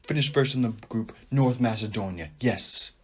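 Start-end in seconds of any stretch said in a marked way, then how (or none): none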